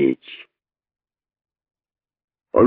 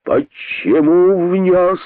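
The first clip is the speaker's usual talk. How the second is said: slow high accusing cry; king's shout; 8 semitones higher, much slower than usual. A narrator's reading. King pointing at the culprit, shouting. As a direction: accuse him with a yell. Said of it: "закричал король".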